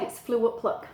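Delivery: ordinary delivery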